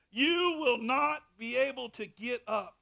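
loud, high, rising preacher's cry; fiery preaching. Acted. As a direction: preach it hard at the congregation. Preaching at full force.

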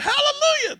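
high soaring hallelujah; Jesse Duplantis hallelujah. Retold or imitated. Pastor quoting a preacher's hallelujah. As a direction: toss it up high like a famous preacher. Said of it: "I got that from Jesse Duplantis".

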